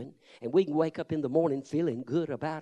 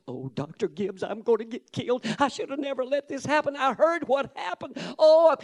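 rising higher and louder into a frantic wail; panicked assistant pastor; 8 semitones higher, 4 dB louder than usual. Retold or imitated. Assistant pastor panicking to Doctor Gibbs, climbing to a frantic cry. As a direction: panic more with every line until the final oh bursts. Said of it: "come roaring down the aisle".

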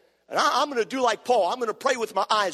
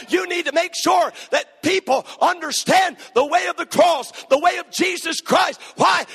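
loud, high, shouting revival preaching; preaching at full force; 7 semitones higher, 5 dB louder than usual. Acted. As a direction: drive it home to the congregation.

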